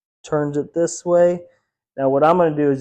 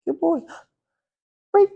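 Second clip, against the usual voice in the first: high praise; 13 semitones higher than usual. Real, not acted. Praising the dog.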